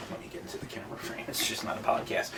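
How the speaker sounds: breathless whispered aside, almost under the breath. Real, not acted. To the co-host while stepping into the camera frame, a hushed whisper. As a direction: whisper it as a quick aside to yourself.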